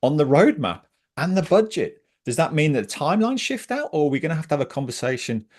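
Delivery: passionate. Real, not acted. Coach putting hard questions about the missed increment, passionately. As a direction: press each question with real passion. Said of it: "you can hear the passion in my voice".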